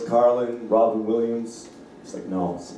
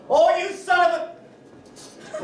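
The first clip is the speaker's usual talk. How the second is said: mother's outburst; much slower than usual. Retold or imitated. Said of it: "It's like".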